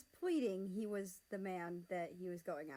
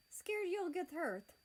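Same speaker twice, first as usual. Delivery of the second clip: teasing; 10 semitones higher, slower than usual. Acted. America teases Russia.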